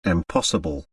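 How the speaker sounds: low, soft astonished mutter. A narrator's reading. Doctor reading the letter, low stunned mutter. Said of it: "he muttered".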